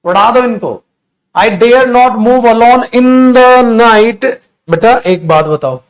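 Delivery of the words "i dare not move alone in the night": slow dictation. Shown as a demonstration. Teacher dictating sentence loudly.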